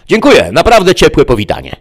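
high, loud mock-gracious devil; a vain demon author accepting applause; high devilish politeness; Mefisto's voice. Mefisto thanks audience.

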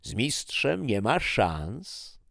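high, slow gloating boast; a smug, unhurried jab at the loser; boastful taunt. Acted. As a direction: drag out the brag like a champion. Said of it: "słyszałem".

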